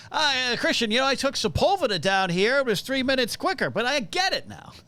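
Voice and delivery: high, animated take on dad's chatter; dad impression. Self-mocking son slipping into dad's route talk, dad impression. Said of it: "I gotta stop myself from doing the".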